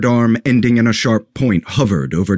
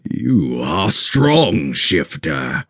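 stern guardian voice; 3 semitones lower, much slower than usual. Acted. Guardian Olmec addresses the creature.

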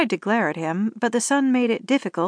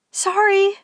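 high, bright call through the glass; called sorry; 11 semitones higher, 4 dB louder than usual. A narrator's reading. Driver calling to officer through window, high apologetic call. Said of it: "she called through the glass".

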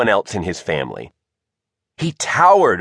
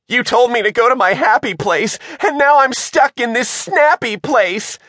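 high-pitched panicky complaint; screech; 13 semitones higher than usual. A narrator's reading. Shivers, head coated in clams, screeches at Margot. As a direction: screech the accusation at your friend. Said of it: "he screeched".

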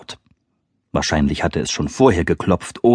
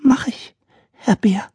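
high timid peep fading to whisper; peeping girl; 14 semitones higher than usual. Acted. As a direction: squeak it like a shy child. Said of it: "piepste sie".

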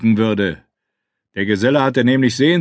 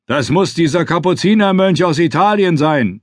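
raised voice over a noisy crowd; shouting; 7 semitones higher than usual. Acted. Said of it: "schrie ich Rechberg ins Ohr".

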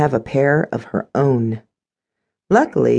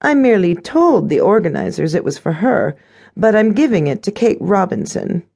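raised-pitch stepmother voice; scheming stepmother; 7 semitones higher than usual. A narrator's reading. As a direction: explain it away as the haughty stepmother.

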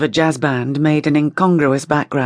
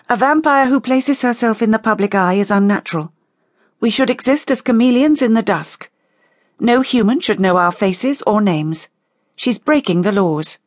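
higher, insistent character voice; stern condemnation; 6 semitones higher than usual. A narrator's reading. Rachel condemns the vampire in the public eye, stern. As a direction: lay down the law, pitched up.

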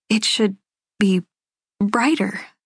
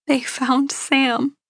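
higher, very slow, emotional delivery; shaky mom; 6 semitones higher, much slower than usual. A narrator's reading. Mother breaking news to her daughter, shaky. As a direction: let each word out slowly, with feeling. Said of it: "her voice sounding shaky".

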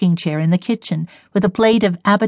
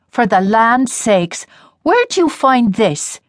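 put-on slow, higher old woman's voice, wary; drawn-out gruff granny suspicion; suspicious old grandmother; 4 semitones higher, slower than usual. A narrator's reading. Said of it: "looking up suspiciously".

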